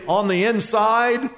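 ordinary delivery

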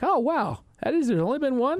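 high-pitched, amazed reaction; mock surprise. Host recalling his reaction to the pregame stat, surprised. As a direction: act out being taken aback, voice leaping up.

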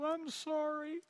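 put-on high, slow little-girl voice with a quiver; imitating tearful daughter. Father imitating his daughter's teary apology, high and small. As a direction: play his crying little princess, small and drawn out. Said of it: "lips begin to quiver and tears".

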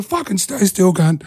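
high, laughing command to the pinned guy; mock stern order. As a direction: bark it like you're losing patience, half-joking. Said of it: "And I'm just gonna".

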